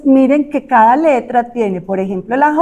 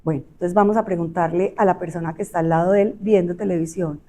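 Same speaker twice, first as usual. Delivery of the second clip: normal talking voice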